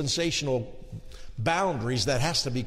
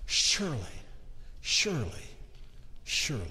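fading away; 3 semitones lower, 7 dB quieter than usual. Real, not acted. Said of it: "fading repetition".

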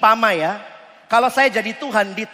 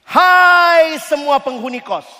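slow, loud hallway call-out; mock shout; 5 semitones higher, 6 dB louder, slower than usual. Pretend tenant calling everyone out at midnight, mock shout. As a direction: call the whole boarding house out.

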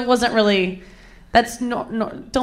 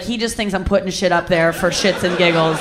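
normal talking voice

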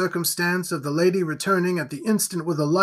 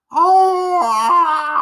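high, loud howling cry; monstrous howl; 12 semitones higher, 9 dB louder than usual. A narrator's reading. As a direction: let out a beastly howl. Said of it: "A strange howling noise came from the throat".

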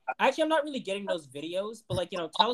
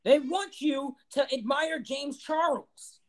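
higher, slow, dramatic warning; a put-on higher voice, slow and solemn; mock conspiracy voice; 7 semitones higher, much slower than usual. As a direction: ham up the paranoid prophet.

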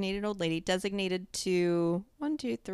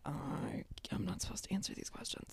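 softer whispered excuse; 13 dB quieter than usual. Retold or imitated. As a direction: whisper it as if hiding from customers. Retelling dodging customers' questions.

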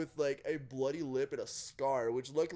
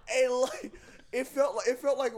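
laughing; 7 semitones higher, 5 dB louder than usual. Real, not acted. Still laughing while telling.

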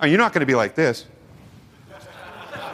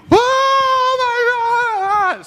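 high, loud horrified shriek; a sudden, very high cry of disgust and alarm; shocked parent cry; 4 dB louder, much slower than usual. Pretend. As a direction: shriek it like a parent who just found the mess. Parent shocked at child eating poop.

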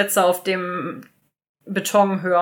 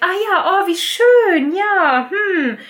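much higher, bright put-on delight over shock; forced surprise; 8 semitones higher, slower than usual. Mary hears of Matthew's engagement, forced high delight. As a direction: pretend to be pleased while thrown. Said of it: "ist immer noch sehr überrascht".

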